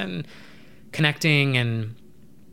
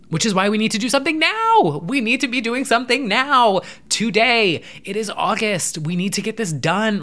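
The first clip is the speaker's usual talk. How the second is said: raised, loud, pressing call to act now; rally the viewers like a stump speech; high, loud and urgent campaign pitch; urgent rallying cry; 8 semitones higher, 4 dB louder than usual. Real, not acted. Urgent plea for action.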